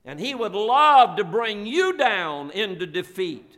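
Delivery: impassioned pulpit crescendo; preaching at full force. Acted. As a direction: build the sermon up, pressing it on the congregation. Preaching builds to a climax.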